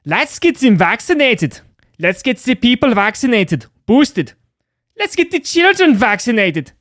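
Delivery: reading a news quote as a hammed-up German officer; crazy German accent. Shown as a demonstration. Over-the-top German accent, barked high. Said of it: "in a crazy German accent".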